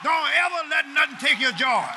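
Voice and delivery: full-force preaching. Real, not acted. Preacher urging the church to take back their joy, full-force preaching. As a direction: command the church with rising fervour.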